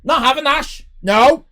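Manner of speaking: shouting in hammed-up indignation; mock outrage. Real, not acted. Streamer shouting back at a rude viewer in mock fury. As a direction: yell it like a wounded showman. Streamer rants at chat insult.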